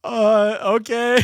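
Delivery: high, loud laughing uh okay; awkward laughing okay. Retold or imitated. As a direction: caught out, laugh it off. Awkward reply to grandpa.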